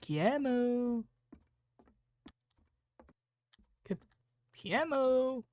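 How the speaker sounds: calling out. Real, not acted. Calling out for Kiemu in game.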